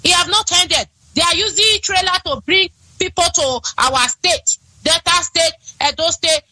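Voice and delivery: ordinary talk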